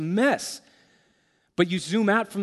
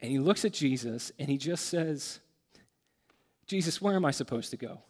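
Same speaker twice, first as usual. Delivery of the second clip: choked up; 3 semitones lower, 6 dB quieter than usual. Real, not acted. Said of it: "I can't even talk about it".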